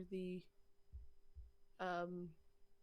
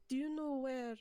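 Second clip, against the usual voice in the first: raised, higher call to someone nearby; 6 semitones higher, 4 dB louder than usual. Pretend. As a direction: call out a question across a short distance. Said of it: "just gonna call out".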